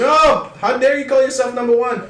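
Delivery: high, loud, outraged squeal; indignant mock shout. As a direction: jump in with a high, loud, offended "I'm number one". Mock rivalry over restaurant name.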